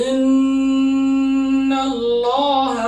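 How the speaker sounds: Allah chanted with a thick, heavy lam in ইন্নাল্লাহ. Shown as a demonstration. Quran teacher chanting ইন্নাল্লাহ slowly with a heavy lam. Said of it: "যেমন".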